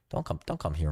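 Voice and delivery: usual speaking voice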